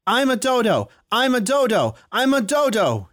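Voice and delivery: screaming. Acted. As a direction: scream it out with all you have. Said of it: "he screamed".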